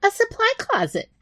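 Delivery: high rat voice, matter-of-fact. Acted. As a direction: state it like anyone would know. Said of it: "Sneaker repeated matter of fact".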